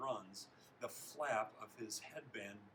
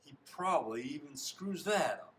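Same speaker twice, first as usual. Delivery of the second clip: high, loud mocking taunt; jeering; 4 semitones higher, 8 dB louder than usual. A narrator's reading. Teammates pile on the loser, jeering. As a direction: toss it out as a scornful jeer.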